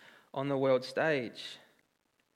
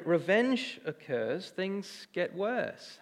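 very slow, softer, weighing each word; slow emphatic; 5 dB quieter, much slower than usual. Acted. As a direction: let "things get worse" sink in slowly. Preacher driving home point.

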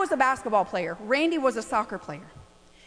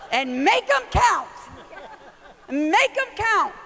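drawn out, higher, each word leaned on; slow insistent repeat; 7 semitones higher, slower than usual. Retold or imitated. Mom to son about fouls, slow and insistent. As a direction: press it home slowly. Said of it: "I said".

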